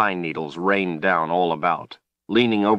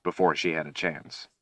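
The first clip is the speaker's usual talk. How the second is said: ordinary delivery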